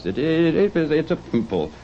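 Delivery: muttered excuse, voice catching. A narrator's reading. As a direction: cover rising emotion with a stammered excuse. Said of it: "muttered with an unusual catching in his voice".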